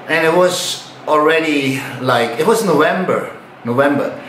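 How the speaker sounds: ordinary delivery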